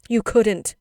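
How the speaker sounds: high, loud shocked protest; cried out. A narrator's reading. Children hear mother's threat to leave, crying out. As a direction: burst out like horrified children. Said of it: "they cried".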